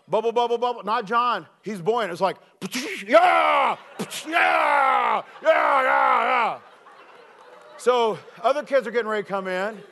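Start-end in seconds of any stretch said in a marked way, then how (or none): 3.0-6.6 loud, high whooping yeahs; triumphant shout. Retold or imitated. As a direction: yell each yeah on surfacing. Buoyant Johnny popping up, cheering.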